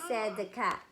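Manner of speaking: ordinary delivery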